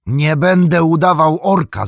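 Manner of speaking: wyżej i głośniej, stanowczy okrzyk; zawołanie. A narrator's reading. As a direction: wykrzycz to zdecydowanie. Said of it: "zawołał". (Frodo woła do Sama, że nie będzie udawał orka, głośno)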